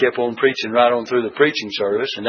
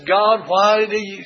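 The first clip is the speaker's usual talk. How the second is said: higher, slow whiny plea; self-pitying whine; 7 semitones higher, slower than usual. Retold or imitated. Elijah complaining to God while fleeing Jezebel, higher whine. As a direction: whine like a sulking child. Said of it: "this pouting pity party".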